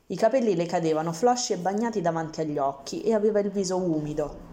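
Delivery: usual speaking voice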